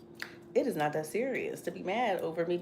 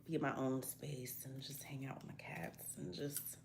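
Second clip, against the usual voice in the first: whispered, slow, dreamy; hushed whisper; 4 semitones lower, 14 dB quieter than usual. Real, not acted. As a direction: breathe it out like a secret. Drifting into a whisper.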